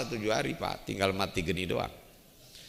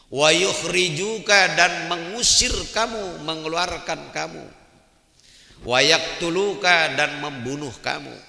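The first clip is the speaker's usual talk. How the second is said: preaching at full force; 7 semitones higher, 9 dB louder than usual. Acted. Preacher drives the verses home to the congregation, fiery and loud. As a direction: hammer each verse home.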